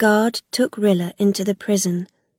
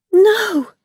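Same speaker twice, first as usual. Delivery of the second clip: shocked high loud cry of denial; a sudden pained cry; 12 semitones higher, 6 dB louder than usual. Acted. Rilla crying out at news of Zadak's death. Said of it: "Rilla cried".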